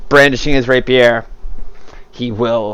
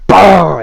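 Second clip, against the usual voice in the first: punched, higher, louder boom; impact noise; 6 semitones higher, 6 dB louder than usual. A narrator's reading. Narrator sounding out a blow on Rosin, punchy boom. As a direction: hit it like a blow landing. Narrating blows landing in combat.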